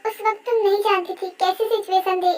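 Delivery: ordinary talk, nothing marked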